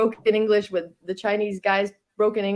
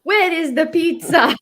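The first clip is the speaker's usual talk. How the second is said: high, slow mock outrage; Italian accent, hammed up; imitating angry Italian guy; 10 semitones higher, 6 dB louder, slower than usual. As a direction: ham up a frustrated diner demanding pizza. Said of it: "He's like".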